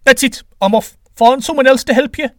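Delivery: high, loud huffy outburst; offended god quitting on the spot; indignant retort. A narrator's reading. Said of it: "retorted Quetzalcoatl".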